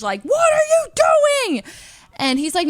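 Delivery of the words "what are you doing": high-pitched, drawn-out angry shout; road rage yell. Retold or imitated. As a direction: imitate a driver yelling through the window. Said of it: "yelling at us through his window".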